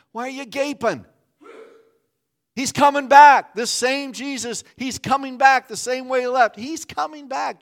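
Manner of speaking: high, loud proclaiming; He's coming back, shouted in triumph; full-force preaching. Real, not acted. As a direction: preach it out to the crowd. Preaching climax, Christ returns.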